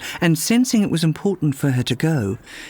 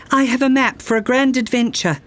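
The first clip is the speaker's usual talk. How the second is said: high, bold announcement to the room; announcing; 9 semitones higher, faster than usual. Acted. Girl announces her quest to a tavern, bold announcement. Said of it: "she announced".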